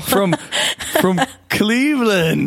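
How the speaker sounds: high, drawn-out hammed-up cheer; mock grand announcement. Host correcting the listeners' city, high laughing fanfare. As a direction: belt out "Cleveland" slowly through laughter.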